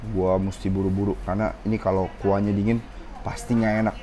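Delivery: ordinary talk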